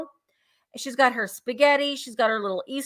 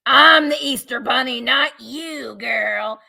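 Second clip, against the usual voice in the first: mock Easter Bunny villain; 4 dB louder, slower than usual. Seller voicing the bunny figurine to viewers, hammed-up and scheming. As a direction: play the scheming bunny taking over. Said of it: "he's like".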